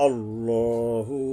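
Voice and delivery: আল্লাহু with one alif stretch, no a-sound, very slow; stretched Allahu. Shown as a demonstration. Teacher shows the correct আল্লাহু, slow chant. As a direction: hold আল্লাহু long and rounded. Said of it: "একালিপ টান এবং আকার ছাড়া".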